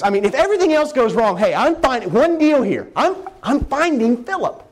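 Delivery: building excitement up to a high, bright finish; eager resolve. Acted. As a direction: sound like nothing could stop you. Jesus voiced, set on Philip.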